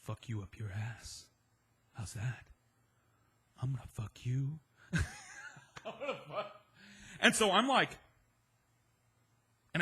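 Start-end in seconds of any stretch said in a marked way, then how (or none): 0.0-6.6 menacing creepy look. Retold or imitated. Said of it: "a look I can only describe".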